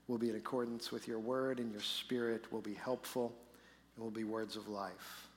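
soft, low, reverent prayer. Acted. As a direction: pray quietly before the sermon. Leads the congregation in prayer.

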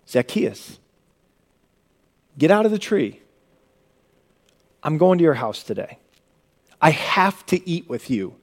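soft, tender call to Zacchaeus; gentle Jesus. Retold or imitated. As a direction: say it quietly, kindly, as an invitation.

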